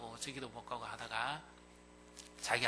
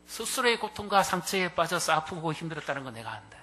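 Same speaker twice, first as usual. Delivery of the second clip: rising, louder and higher compassion; impassioned tenderness; 7 semitones higher, 8 dB louder than usual. Acted. Jesus pouring out understanding to Peter. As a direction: build the feeling line by line.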